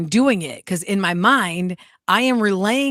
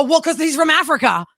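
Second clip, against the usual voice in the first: higher-pitched, emphatic self-justification; indignant retort; 8 semitones higher, 4 dB louder than usual. Retold or imitated. Host retelling her defence of imitating a man's accent, protesting. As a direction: protest in a high, lively voice. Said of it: "I was doing his accent".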